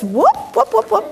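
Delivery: quick rising little whoops; playful bird noises. Pretend. Imitating a bird for children.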